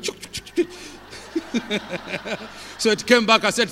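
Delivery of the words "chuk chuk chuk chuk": repeated chuk sound effect, breaking into laughter; chuk-chuk noise trailing into a laugh; imitated chuk noise. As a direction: mimic a thing being passed along. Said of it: "You know, it went".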